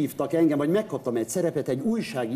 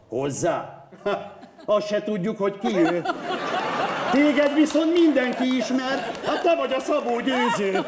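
surprised extra; 9 semitones higher than usual. Retold or imitated. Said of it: "Erre Laci".

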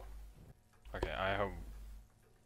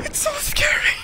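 crying shout; 10 dB louder than usual. Real, not acted. Scream-crying in fear.

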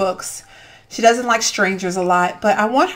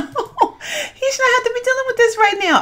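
laughing scold; 13 semitones higher than usual. Real, not acted.

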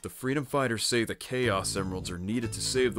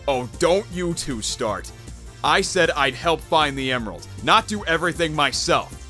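raised, fed-up scolding; exasperated complaint; 6 semitones higher, 3 dB louder than usual. Acted. To bickering teammates, exasperated. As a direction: scold them, patience used up.

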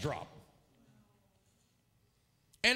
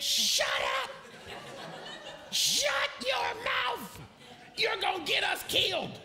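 re-enacted yell, stretched out and pitched up; 11 semitones higher, slower than usual. Preacher silencing the panicked prayer leader, yelling. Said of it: "I'm yelling at her to shut up".

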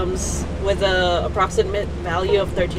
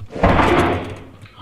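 startled gasp. Real, not acted. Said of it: "that scared the shit out of me".

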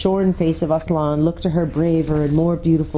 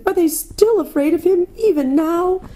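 tearful; 14 semitones higher than usual. A narrator's reading. Susan watching the crowd, high tearful disbelief. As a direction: cry out in disbelief. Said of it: "sobbed Susan".

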